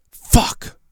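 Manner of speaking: breathy muttered curse; frustrated whisper. Real, not acted. Streamer cursing to himself under his breath. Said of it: "I'm so pissed off".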